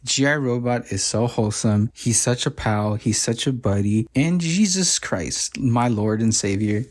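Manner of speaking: ordinary talk, nothing marked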